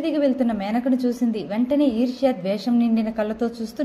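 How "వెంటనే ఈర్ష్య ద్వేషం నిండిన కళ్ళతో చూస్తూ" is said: ordinary delivery, nothing marked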